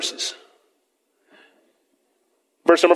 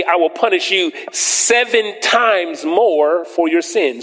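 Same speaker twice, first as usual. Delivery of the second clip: raised, declaiming Bible reading; preacher reading scripture aloud to the church; 2 semitones higher than usual. Acted. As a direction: proclaim the verses from the pulpit.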